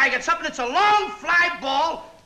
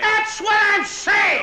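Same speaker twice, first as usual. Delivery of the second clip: slow, shouted insistence; worked-up shout at his partner; excited; 6 semitones higher, slower than usual. Acted. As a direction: insist at full volume, fed up. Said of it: "Don't get excited".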